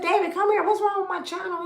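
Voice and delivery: high, loud alarmed call to her son. Retold or imitated. Mother calling her son over her channel, alarmed. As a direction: call out as if something broke. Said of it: "I said".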